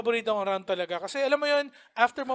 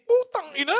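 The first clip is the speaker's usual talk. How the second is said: mock outburst; 5 semitones higher, 5 dB louder than usual. Mock chess player outburst.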